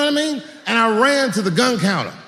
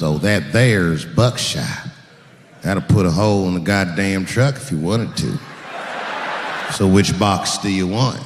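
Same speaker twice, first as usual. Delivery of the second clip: much lower, slow country drawl; country clerk; 10 semitones lower than usual. Retold or imitated. Gun clerk describes buckshot, much lower slow drawl. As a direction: lean into a slow rural drawl.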